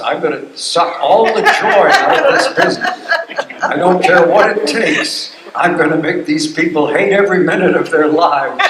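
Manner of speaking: loud, high, emphatic vow; hammed-up oath of a joy-killing boss; mock villainous administrator. Acting out joyless administrators.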